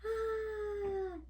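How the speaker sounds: a sudden high frightened cry; scared high cry. Retold or imitated. Frightened seed yelps in alarm. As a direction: yelp like a scared little seed. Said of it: "They're even looking scared".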